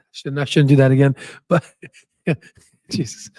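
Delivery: ordinary talk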